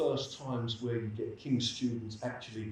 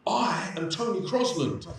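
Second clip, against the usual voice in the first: raised, high-pitched declaration; imitated shout; 8 semitones higher, 6 dB louder than usual. Student shouting out in a coffee shop, imitated loud cry. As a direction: call it out loudly, imitating someone shouting. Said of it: "standing up and shouting out".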